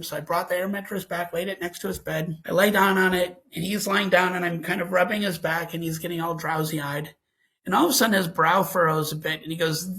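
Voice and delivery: plain reading voice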